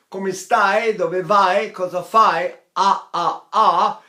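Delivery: Italian phrases with open a; Italian vowels. Shown as a demonstration. Teacher modelling the Italian open a. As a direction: open the a in "stai" wide and bright. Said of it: "So if I say".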